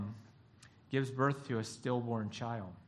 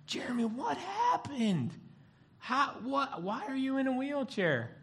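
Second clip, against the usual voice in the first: a hint of a gasping church friend, pitched up; concerned churchgoer; 10 semitones higher than usual. Churchgoer voiced asking concerned.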